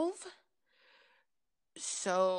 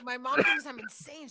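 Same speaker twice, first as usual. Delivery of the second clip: ordinary talk, nothing marked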